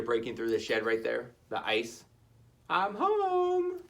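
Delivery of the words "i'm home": put-on high, relieved child voice; Shelley arriving back home; happy little Shelley. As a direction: sigh it out glad to be home.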